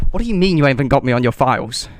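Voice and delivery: mock outraged guest; incredulous outrage. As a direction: demand an explanation at the desk. Outraged at missing booking.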